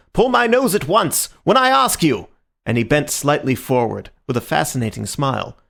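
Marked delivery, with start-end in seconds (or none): 0.1-2.3 urgent insistence. A narrator's reading.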